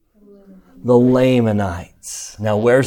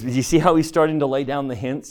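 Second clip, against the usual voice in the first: ordinary delivery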